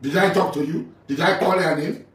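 indignant. Real, not acted. Indignant at being called.

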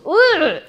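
a sharp high groan of disgust; disgusted ugh. Real, not acted. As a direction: groan at the thought of calculus. Reacts with disgust to "cálculo.

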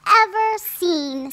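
high, bright amazement; delighted wonder. A narrator's reading. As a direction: gasp with joy at the dress. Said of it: "said Cinderella".

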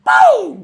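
very high, loud burst; sudden sharp pop. Acted. Teacher celebrating the answer, loud pow. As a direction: fire it off like a shot.

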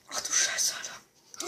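breathy shocked whisper. Real, not acted. Gamer whispering in shock at the screen. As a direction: hush it out in shock.

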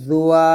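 জুয়া said loud as mistake; wrong zuya. Shown as a demonstration. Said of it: "আমরা অনেকে বলি". (ভুল উচ্চারণ জুয়া)

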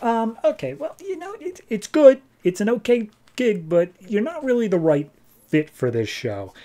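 high-pitched, hesitant judge voice; awkward polite letdown. Acted. Judges awkwardly letting an act down.

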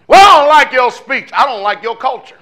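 high, loud, scornful mock complaint; mocking objector. Preacher mocking critics who dislike his speech, loud sneer. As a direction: sneer it as the other side. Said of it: "when they hear me".

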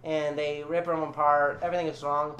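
usual speaking voice

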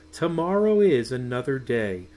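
usual speaking voice

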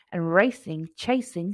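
usual speaking voice

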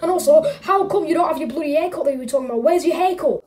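normal talking voice

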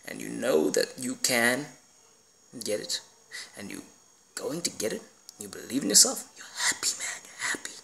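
drops from a hush to a breathy whisper; fading to a whisper. Real, not acted. Talking to his viewers about goals, fading to a whisper. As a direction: let it die away into a whisper.

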